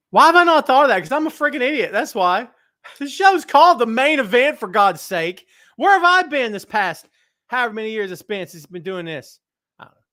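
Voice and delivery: exasperated self-scolding. Real, not acted. Host annoyed he missed the obvious name, loud exasperated rant. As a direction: berate yourself, half laughing. Exasperated at his own oversight.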